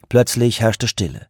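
ordinary delivery, nothing marked

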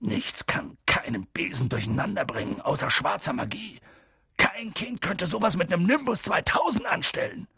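upset giant, rising to a high finish; tremble, then rise in disbelief; trembling voice. A narrator's reading. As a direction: shaken character voice building in agitation. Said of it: "meinte Hagrid mit zitternder Stimme".

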